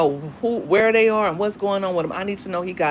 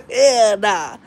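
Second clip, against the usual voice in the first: louder mock Mississippi country refusal; country accent; 2 semitones higher, 6 dB louder than usual. Stepdad vetoing porch visits, mock country accent. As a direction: imitate a country stepdad putting his foot down. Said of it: "He have a country accent".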